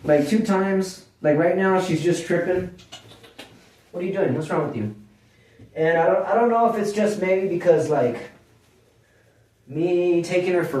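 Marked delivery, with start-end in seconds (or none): 3.9-4.9 hushed scold to a misbehaving dog; quiet scolding of dog. Real, not acted. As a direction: murmur it at the dog, puzzled. Scolding the dog mid-video.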